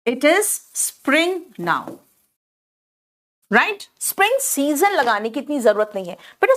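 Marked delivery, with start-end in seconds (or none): 0.1-1.9 it is spring now spelled out word by word, very slowly; teacher drilling the class, very slow and clear; very slow model sentence. Shown as a demonstration. English teacher modelling a phrase for pupils, very slow. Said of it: "तो मैं कहूंगी वसंत है".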